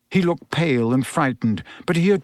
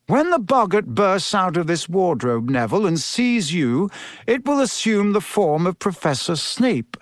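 encouraging teacher, pitched higher; Professor Lupin; 5 semitones higher than usual. Acted. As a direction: coach a pupil with lifted, lively intonation.

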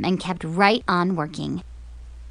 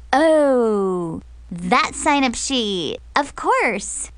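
sudden realization; 4 semitones higher, much slower than usual. Acted. Zoe catching on, faking.

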